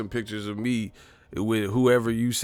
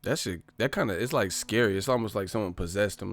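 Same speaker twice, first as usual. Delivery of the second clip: ordinary talk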